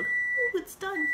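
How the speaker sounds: high sing-song ooh. Real, not acted. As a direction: chirp it high, pleased it's ready. Reacting to the microwave finishing.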